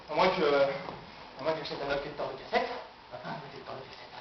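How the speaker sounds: hushed, dwindling afterthought; tentative idea. Acted. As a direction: think aloud, almost losing your voice. Tentative new idea trails away.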